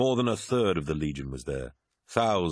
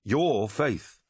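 clipped, stress on Your; a curt jab at his friend; snapped; 5 semitones higher than usual. A narrator's reading. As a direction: land hard on Your, then fall. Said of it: "snapped Arukin".

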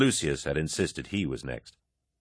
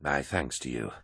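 low, soft gracious thanks; soft-spoken, gracious loser; winded, smiling; 6 semitones lower, 6 dB quieter than usual. A narrator's reading. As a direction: answer quietly, a touch spent. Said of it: "breathing hard".

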